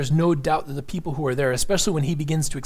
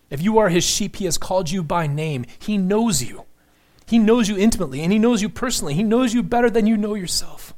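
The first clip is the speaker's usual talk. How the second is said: higher and louder, pressing each "knows"; preaching at full force; 5 semitones higher, 4 dB louder than usual. Acted. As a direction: drive it home to the congregation, rising.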